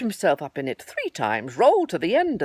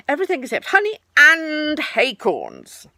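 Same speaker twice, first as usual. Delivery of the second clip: higher, louder Tigger amending; 10 semitones higher, 9 dB louder than usual. Acted. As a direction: add the new exception confidently.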